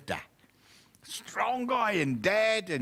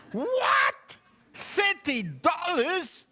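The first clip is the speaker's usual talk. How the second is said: much higher, slow disbelieving "Thirty dollars?"; shocked buyer; 12 semitones higher, much slower than usual. Acted. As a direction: gasp at the price, stretching "Thirty".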